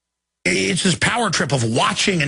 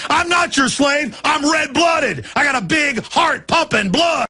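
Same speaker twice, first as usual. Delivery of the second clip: high, loud shouting with stretched words; furious defiant rant; 6 semitones higher, 4 dB louder than usual. Real, not acted. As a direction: roar defiance at would-be slave masters.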